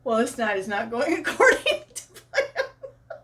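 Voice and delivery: words breaking into real laughter; amused, laughter interrupting the line; laughing retold quote. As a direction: quote him while cracking up. Laughs through husband's retold complaint.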